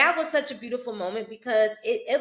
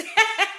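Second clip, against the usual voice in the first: burst of laughter; 10 semitones higher, 6 dB louder than usual. Real, not acted. Laughing about funny character.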